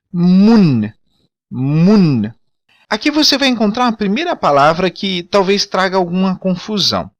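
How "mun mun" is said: Mun with the final N clearly sounded; Mun, "oo" vowel plus a real n; Creole word. Shown as a demonstration. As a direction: say Mun as "moon" and close on the n. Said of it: "Note que o N no final, ele é pronunciável".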